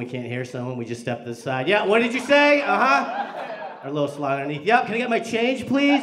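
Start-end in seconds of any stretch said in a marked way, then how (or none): 1.7-3.3 raised voice, much higher and louder; mock hard-of-hearing shout; calling through glass. Acting out not hearing through windows. 4.7-6.0 high, loud mock request; hammed-up raised voice to a cashier; calling through glass. Acting out talking under covid window.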